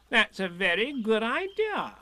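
high-pitched, emphatic approval; keen little rabbit; approving Rabbit. A narrator's reading. Rabbit approves Pooh's idea.

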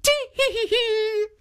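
very high tittering giggle; high old woman's tee-hee. A narrator's reading. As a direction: giggle it out high like a tickled granny. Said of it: "still laughing".

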